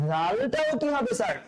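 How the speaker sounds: normal talking voice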